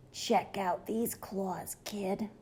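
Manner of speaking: slow, soft, rasping monster whisper; a creepy monster rasping up from under the bed. A narrator's reading. Third monster rasps at the kid from under the bed. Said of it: "a third voice from under the bed rasped".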